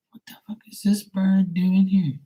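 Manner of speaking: high, unvoiced shocked whisper; stunned whisper. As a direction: whisper it in disbelief, eyes on the sky. Shocked at big bird overhead.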